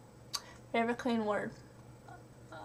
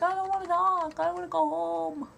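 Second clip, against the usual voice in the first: higher, louder mock whine; groggy tired whine; 6 semitones higher, 4 dB louder than usual. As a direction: imitate your sleep-deprived self moaning. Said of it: "I was just like".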